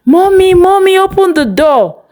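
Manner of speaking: put-on high, loud child calling for mommy; a little child shouting for mom outside the gate. A narrator's reading. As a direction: yell it up to the gate like an eager kid.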